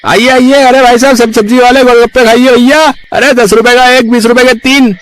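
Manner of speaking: very high, loud hawker's cry; street vendor calling. Acted. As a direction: shout out to passers-by like a street seller. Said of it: "ठेले वाले ग्राहकों को आवाज लगाकर बुलाता है".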